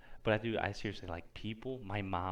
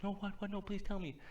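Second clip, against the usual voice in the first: much higher-pitched, mock-eager mom; imitated pleading mom; 12 semitones higher than usual. Mom begging for the story she missed, high mock plea. Retelling mom's belated attention.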